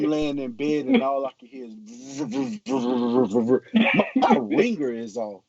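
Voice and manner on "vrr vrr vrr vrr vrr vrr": phone buzz imitation. Retelling the alarm to the host, buzzing like a phone. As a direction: mimic the phone going off.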